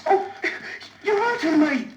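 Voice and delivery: high pained yelp; pained cry. Acted. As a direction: cry out as the arm twists. Arm being twisted.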